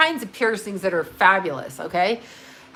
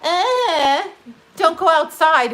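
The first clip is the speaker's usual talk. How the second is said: high, louder whiny mock of the governor; 8 semitones higher, 5 dB louder, slower than usual. Mocking the governor's stay-home order, high and whiny. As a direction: sneer it like a nagging official. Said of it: "Well, Governor".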